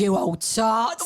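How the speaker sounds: slow, drawn-out Cockney jibe; mock Cockney insult. Co-host demonstrates an old-fashioned insult, drawn-out Cockney. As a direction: stretch it like a Cockney market trader. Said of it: "It's a bit like tart".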